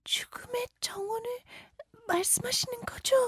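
soft, unvoiced frightened whisper. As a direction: whisper it as if afraid. Virginia asks in fear.

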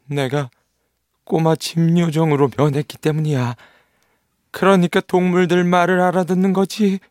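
put-on boy voice. Nils voiced in children's story.

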